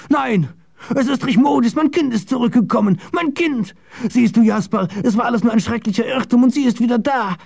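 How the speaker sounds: very high, overwrought insistence; father clinging wildly to good news; desperate joy. Acted. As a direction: cry out in frantic hope, pitched far up. Father believes his daughter is back.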